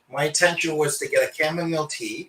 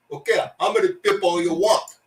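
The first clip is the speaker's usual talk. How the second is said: put-on Hong Kong accent, higher; 4 semitones higher, 4 dB louder than usual. Restaurant man taking a phone order, Hong Kong accent. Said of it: "Hong Kong restaurant".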